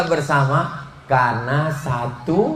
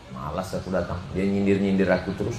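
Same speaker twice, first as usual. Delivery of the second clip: lower, much softer reluctant grumble; sulky grumble; 6 semitones lower, 7 dB quieter, faster than usual. Retold or imitated. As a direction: grumble it sulkily under your breath. Sulky reply of invitee.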